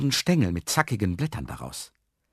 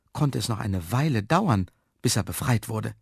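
ordinary delivery

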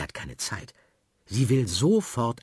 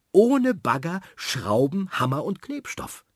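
put-on high, louder, pleased little girl; proud girl; 8 semitones higher, 4 dB louder than usual. Sophie shows off her tower, high and proud. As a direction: show off what she built. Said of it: "sagt sie stolz".

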